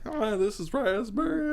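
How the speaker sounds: high, squeaky imitation voice; high squeaky Ricochet voice. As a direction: squeak it out, cartoonish. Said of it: "He'd be like".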